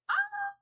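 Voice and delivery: high, loud, brief "Ah!"; a jarring yelp to make someone jump; short alarmed scream. Shown as a demonstration. AI showing a jarring scream, short and alarming. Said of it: "Short, but definitely alarming".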